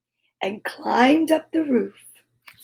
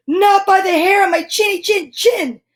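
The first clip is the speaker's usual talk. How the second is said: higher little pig voice; a small pig standing firm, pitched up; 8 semitones higher, 4 dB louder than usual. Acted. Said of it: "said the second little pig".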